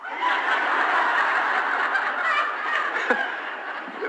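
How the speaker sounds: big unvoiced burst of laughter. Real, not acted. Laughs after being told get out.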